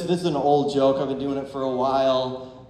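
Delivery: ordinary delivery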